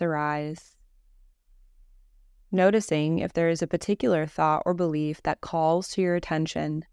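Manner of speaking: calm, even meditation voice with long pauses; guided meditation. Acted. Leads a Yoga Nidra meditation.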